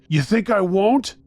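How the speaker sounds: high, loud taunting challenge; angry scream. A narrator's reading. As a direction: shout it as a menacing dare. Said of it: "Earl screamed".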